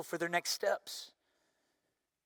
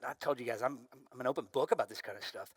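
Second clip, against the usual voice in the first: ordinary delivery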